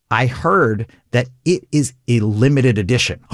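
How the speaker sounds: slow, drawn-out build to a reveal; measured reveal. Shown as a demonstration. Improviser building on a partner's offer, slow reveal. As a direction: stretch it out, word by word.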